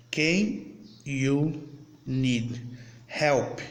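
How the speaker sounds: each word said apart with pauses; word by word demo. Shown as a demonstration. Teacher to students, slowly separating each English word. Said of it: "Aí, vamos lá".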